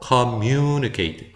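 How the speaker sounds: communicate slowly, opening on a weak "kuh"; schwa first syllable. Shown as a demonstration. Teacher modelling the word, slow communicate with schwa first syllable. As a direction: draw out communicate after a reduced first vowel. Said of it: "강세가 없기 때문에 커로 갑니다".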